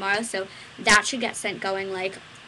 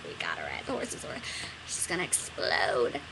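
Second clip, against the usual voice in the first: low urgent aside; 6 dB quieter than usual. Retold or imitated. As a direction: murmur a warning to a colleague. Pleading with mean teacher.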